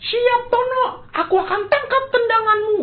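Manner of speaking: put-on eager boy Budi. Budi calls back to Tono that he is ready, high and eager. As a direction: answer brave and ready.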